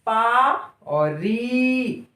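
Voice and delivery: सु, पा, री each said slowly and apart; slow syllable demo. Shown as a demonstration. Teacher showing the mouth shape, slowly. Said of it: "बोलकर देखिए".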